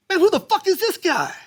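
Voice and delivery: high-pitched indignant disbelief; Rudy Rush. Retold or imitated. Host asking backstage about the unknown comic, high indignant impression. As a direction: squawk it high like an affronted host. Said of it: "And Rudy Rush goes".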